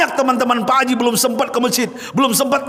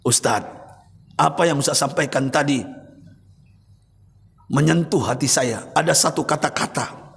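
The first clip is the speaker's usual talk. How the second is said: put-on lower, subdued voice of a tearful old man; mild tearful confession, lower pitch; weeping old man; 6 semitones lower, 4 dB quieter than usual. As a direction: voice the crying rich elder, held low. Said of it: "menangis beliau".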